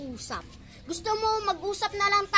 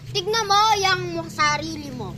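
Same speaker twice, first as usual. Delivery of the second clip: normal talking voice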